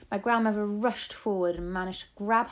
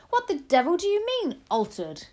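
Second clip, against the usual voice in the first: loud, high outburst, dropping on the last word; incredulous shout; 11 semitones higher, 7 dB louder than usual. A narrator's reading. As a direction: demand it indignantly. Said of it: "shouted Mister Jenkins".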